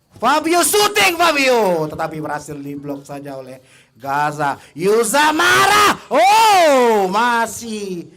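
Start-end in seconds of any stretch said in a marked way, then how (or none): 0.1-1.9 high, loud shot call; excited shout. Real, not acted. Shot on goal. 4.8-8.1 high, loud near-miss cry; excited shout. Real, not acted.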